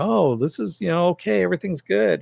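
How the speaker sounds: high-pitched mock cheerfulness; suddenly positive. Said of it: "a lot more positive about it".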